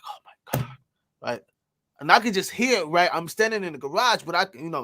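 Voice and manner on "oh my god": retold as an unvoiced whisper; whispered gasp. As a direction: breathe it out in shock, no voice. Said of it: "He was like".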